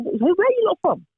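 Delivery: high, eager mock question; curious question. Asking restaurant staff where they're from, high and eager. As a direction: pitch it up like a curious customer. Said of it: "'cause we were like".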